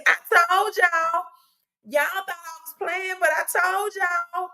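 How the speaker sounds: high Southern gloating "I told y'all"; Southern accent. Shown as a demonstration. Streamer gloating to viewers in a Southern accent. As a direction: rub it in with a Southern twang. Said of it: "my Southern accent".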